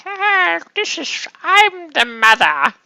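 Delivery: a clumsy stab at an English accent, hammed up; bad English accent. Painter voicing his matronly figure in a bad English accent. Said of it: "I can't do a English accent".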